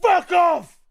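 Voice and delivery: ordinary delivery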